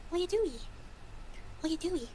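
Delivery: high pet-talk voice; talking to her cat. Acted. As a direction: coo at the cat like a baby. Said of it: "I always say that to my cat".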